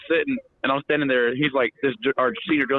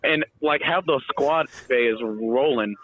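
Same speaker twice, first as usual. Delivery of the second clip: usual speaking voice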